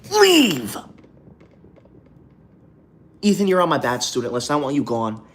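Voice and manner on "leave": sudden high yell; shout. Real, not acted. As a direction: bark it out, high and loud. Shoos a commenter from the live.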